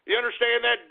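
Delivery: ordinary delivery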